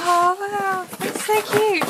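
high, thrilled oh wow over the gift; delighted gasp. Real, not acted. Opening a fan's parcel on camera, delighted and high. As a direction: squeal it out like a happy surprise.

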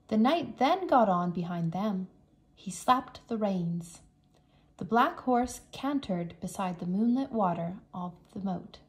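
ordinary delivery

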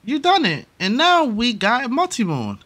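high, cheering celebration; excited. Real, not acted. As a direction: cheer the win. Wins the Multi-Moon.

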